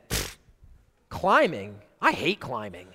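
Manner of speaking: low, scoffing dismissal; mock disdain. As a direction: pretend to pooh-pooh the idea, dropping low. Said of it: "choose to speak in someone else's voice".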